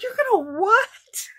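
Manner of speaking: squeaky high, laughing mock outrage; shocked disbelief. Cashier aghast at her cutting up shirts, high shocked squeal. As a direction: play the aghast cashier, voice jumping way up. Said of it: "she about had a fit".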